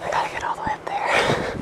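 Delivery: breathy whisper, no voice at all. Real, not acted. Vlogger whispering about the long walk up, hushed whisper. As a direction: say it under your breath to the camera. Walking uphill, whispering to camera.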